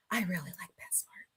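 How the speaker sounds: soft confiding whisper; whispered aside. Real, not acted. Confiding to a viewer about groomers in a whisper. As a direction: lean in and whisper it.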